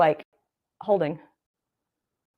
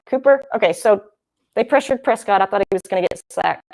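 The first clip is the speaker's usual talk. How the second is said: usual speaking voice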